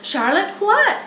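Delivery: high, loud eager question. Acted. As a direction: jump in, dying to know. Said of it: "asked Wilbur eagerly".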